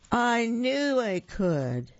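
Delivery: high, slow, proud declaration; little engine crowing at the bottom; triumphant finish. A narrator's reading. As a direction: land it like a victory. Triumphant end of the engine's chant.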